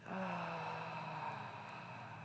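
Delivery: long, soft releasing sigh; demonstrated exhale. Shown as a demonstration. Meditation teacher releasing the breath with the group, soft sigh. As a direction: sigh the whole breath out gently. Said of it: "and out".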